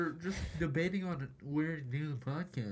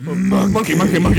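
shouting; 5 semitones higher, 16 dB louder than usual. Real, not acted. Goofing loudly at mic.

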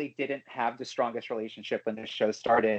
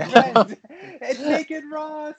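laughing; 7 dB louder, much slower than usual. Real, not acted. Laughing at naked Ross joke.

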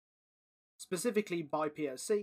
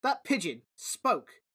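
slow, louder, emphatic insistence; insisting; 6 dB louder, slower than usual. Acted. Dom insisting to the crowd, slow and louder. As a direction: spell it out to them.

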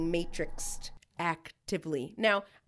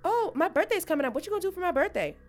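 mock partner voice; 10 semitones higher, 5 dB louder, faster than usual. Voicing clingy partner.

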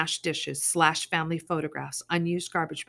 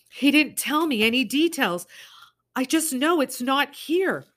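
desperate plea; 10 semitones higher, 6 dB louder than usual. Acted. Summer pleading with thug.

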